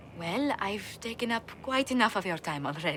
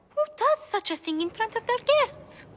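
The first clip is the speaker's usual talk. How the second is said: scandalised exclamation; 5 dB louder than usual. Acted. Scandalised gossip about a soiree.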